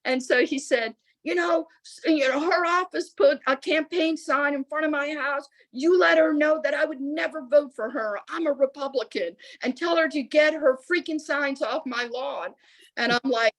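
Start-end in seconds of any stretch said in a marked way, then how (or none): 1.2-12.5 retold irate rant in a raised pitch; mock-angry complaint, higher than usual; angry caller. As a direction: imitate a fed-up man telling her off. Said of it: "that angry".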